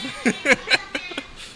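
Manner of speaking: squeaky high laugh; high giggle. Real, not acted. After sauce joke.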